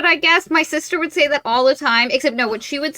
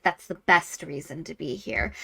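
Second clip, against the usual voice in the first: low male voice; 5 semitones lower, 9 dB quieter than usual. Retold or imitated. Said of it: "he's like".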